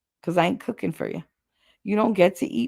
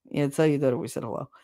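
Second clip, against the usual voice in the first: dismissive tone; 4 semitones lower, 4 dB quieter, faster than usual. Retold or imitated. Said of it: "That, that exact tone".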